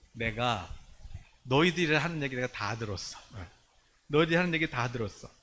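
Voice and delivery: low, measured voice of a judge; stern God. Acted. Voicing God's judgement on the grumblers.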